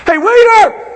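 an impatient customer calling, high and loud; calling the waiter. Retold or imitated. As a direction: holler for the waiter. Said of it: "going like this".

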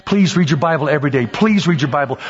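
usual speaking voice

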